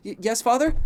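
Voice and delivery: frightened. A narrator's reading. Son answering his furious father, frightened stammer. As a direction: jolt awake scared. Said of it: "in fear".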